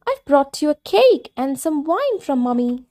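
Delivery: offering gifts sweetly; little girl voice. Acted. Girl speaks to Granny.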